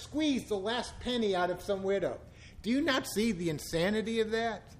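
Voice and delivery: normal talking voice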